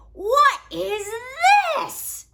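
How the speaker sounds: shocked gasp. Acted. Vulture finds embarrassing photo.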